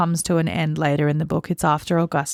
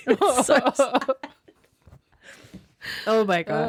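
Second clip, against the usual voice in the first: laughing it out, voice lifting high and slow; a broken laugh at something heartbreaking; sad laugh; 6 semitones higher than usual. Real, not acted. Laughs through a sad passage.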